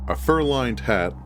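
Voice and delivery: ordinary delivery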